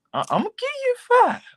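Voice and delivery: playful high-pitched jab; mock squeak. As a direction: repeat the score in a silly high squeak. Judge repeats score, mock squeak.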